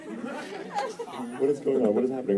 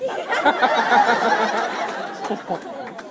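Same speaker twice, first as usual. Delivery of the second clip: amused laugh; 11 semitones higher than usual. Real, not acted.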